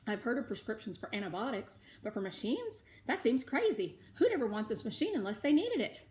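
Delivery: ordinary delivery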